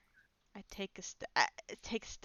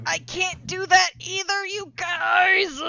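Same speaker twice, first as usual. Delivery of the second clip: laughing shout; 10 semitones higher, 10 dB louder, slower than usual. Real, not acted. Frustrated player protests.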